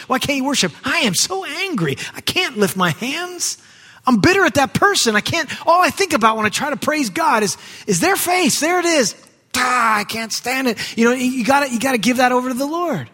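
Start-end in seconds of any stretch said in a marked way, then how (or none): 0.8-11.0 higher, hammed-up frustrated churchgoer; bitter worshipper. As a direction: vent like someone who can't let it go. Bitter worshipper quoted in sermon.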